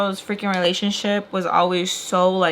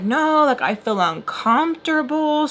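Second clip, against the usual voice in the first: high, insistent protest; mock yell, mostly in the pitch; retold yelling; 7 semitones higher than usual. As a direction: protest in a raised, imitated argument voice. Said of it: "we're yelling".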